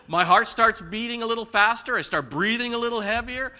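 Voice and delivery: loud, high, mounting agitation; getting worked up. Shown as a demonstration. Preacher listing his own anger signs, worked up. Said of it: "talking faster and louder".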